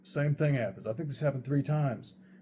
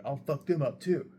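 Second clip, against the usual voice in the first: mock belligerent threat, pitched up; higher, put-on aggression; retold threat; 4 semitones higher, 4 dB louder than usual. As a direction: imitate a hothead threatening everyone.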